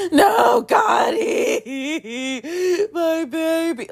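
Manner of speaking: high shouted sob, breaking into a cry; inner wailing scream. Retold or imitated. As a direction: wail it like your heart is breaking. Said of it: "Like, screaming, crying".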